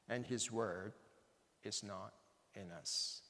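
measured reading broken by long weighty pauses; emphatic pulpit reading, dropping away at phrase ends; solemn scripture reading. Acted. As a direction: proclaim the verses, leaning hard on key words. Reads Scripture aloud to congregation.

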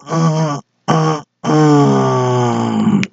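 high pinched straining grunts. Acted. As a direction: push it out with strained hums. Straining on the toilet. (憋劲的闷哼)

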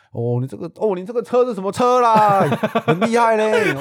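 put-on high, loud gushing old-man voice; old man praising the rider, hammed up high; imitating the old man. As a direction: praise like an impressed old uncle. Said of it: "口气就是这样子".

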